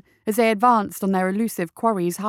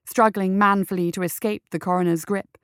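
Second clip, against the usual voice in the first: ordinary delivery, nothing marked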